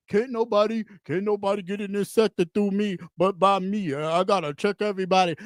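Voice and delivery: low, self-important mock quote; mock gatekeeper. Mimics self-appointed gatekeepers.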